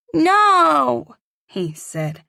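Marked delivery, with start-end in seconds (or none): 0.1-1.2 put-on high, loud child's no; scared little boy refusing. A narrator's reading. Boy refusing the elevator, high, loud and scared. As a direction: refuse like a frightened small boy. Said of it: "in that cute little accent".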